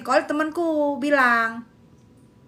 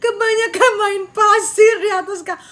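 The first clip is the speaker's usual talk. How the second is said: laugh-crying outburst; 5 semitones higher, 6 dB louder than usual. Real, not acted.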